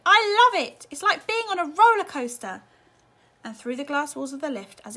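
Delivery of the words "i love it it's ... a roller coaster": high, loud delighted yell; thrilled child's shout; excited shout. A narrator's reading. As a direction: shout it with pure joy. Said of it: "Charlie shouted".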